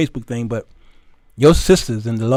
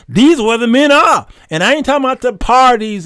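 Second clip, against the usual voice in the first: emphatic preaching; 9 semitones higher, 5 dB louder than usual. Real, not acted.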